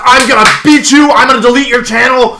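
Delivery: loud, high-pitched acted tirade; going off. Streamer re-enacts Luke blowing up at him, furious shouting. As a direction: build from the name into a shouted threat. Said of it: "He, like, went off on me".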